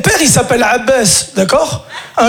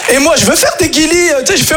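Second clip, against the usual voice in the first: ordinary talk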